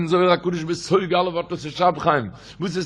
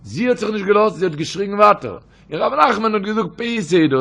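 ordinary talk, nothing marked

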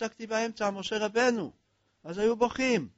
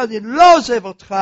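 pleading cry; 3 semitones higher, 13 dB louder than usual. Acted. Moses grips God's garment.

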